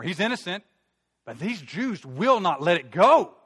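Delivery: slow, loud, hammered final words; acted exasperation, voice swooping high; frustrated protest. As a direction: stretch out every word in frustration. Pilate frustrated at the crowd.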